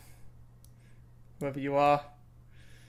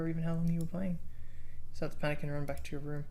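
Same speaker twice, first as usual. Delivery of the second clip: game text reading; 5 dB quieter than usual. Acted. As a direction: read the story text quietly to yourself.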